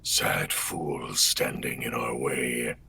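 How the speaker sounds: slow, low whispered menace; Omen voice line. Acted. Redoing chat's favourite on camera, low whisper. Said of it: "the one that everybody wanted earlier".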